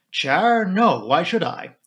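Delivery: startled defensive denial. Acted. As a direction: caught off guard, defensive. Blunt startled by question.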